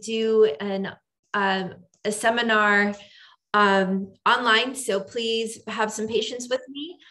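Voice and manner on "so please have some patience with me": ordinary delivery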